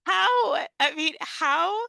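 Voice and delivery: can't believe what she's asking; incredulous laughing question. Real, not acted. To her co-host about interpreting phonics, laughing incredulously.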